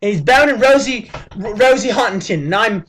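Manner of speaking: hammed-up British accent calling him a git. Vlogger mocking his friend in a British accent. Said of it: "I'm using this accent".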